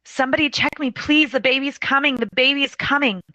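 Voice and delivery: high, urgent pleading; imitating a frantic, high-pitched plea; begging. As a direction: plead desperately to be heard. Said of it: "is begging".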